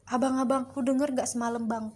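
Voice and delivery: usual speaking voice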